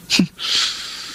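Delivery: soft unvoiced chuckle; sly snicker. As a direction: snicker to yourself at the secret. (mahinang tawang palihim)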